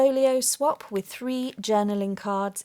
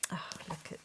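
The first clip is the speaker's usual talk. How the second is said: whisper; 3 semitones lower, 14 dB quieter, faster than usual. Real, not acted. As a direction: breathe it out in awe. Hushed wonder at gift.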